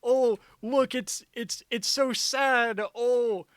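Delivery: high, loud, sappy mock sympathy; mock them in a gushing high voice; high gushing sarcastic sob-story voice. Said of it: "they're like".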